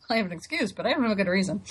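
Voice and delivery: usual speaking voice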